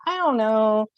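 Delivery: high, drawn-out doubtful hum; hesitant. Acted. Cassandra unsure about Apollo's gift, high and hesitant.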